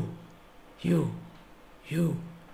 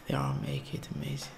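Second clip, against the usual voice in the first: fast, breathy whispered echo; 7 dB quieter, much faster than usual. Real, not acted. Vlogger whispering his awe at the video. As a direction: repeat it to yourself in a quick whisper.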